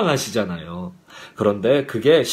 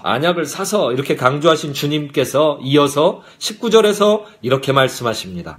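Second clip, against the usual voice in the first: usual speaking voice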